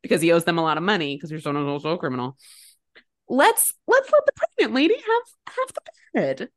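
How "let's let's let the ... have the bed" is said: exasperated plea. Real, not acted. Exasperated appeal for pregnant woman.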